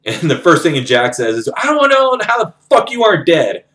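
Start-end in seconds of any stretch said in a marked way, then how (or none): 1.6-3.6 high-pitched retold disbelief; incredulous outburst. Jack to friend presumed dead, in astonished disbelief. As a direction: blurt it out high, acting out astonishment.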